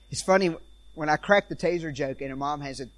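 ordinary delivery

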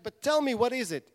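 high, loud, eager question; eager demand. Acted. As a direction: beg to hear the story. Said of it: "It's like".

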